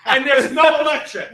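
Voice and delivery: incredulous storyteller at full volume; exasperated rant. Retold or imitated. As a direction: hit each missing thing harder in disbelief. Storyteller's mounting disbelief at missing hookups.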